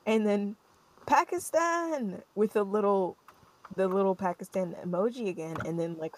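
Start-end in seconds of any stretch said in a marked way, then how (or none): 1.1-2.2 high, drawn-out "Pakistaaan"; mock exclamation. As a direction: sing it out like a message full of exclamation marks. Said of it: "five exclamation points".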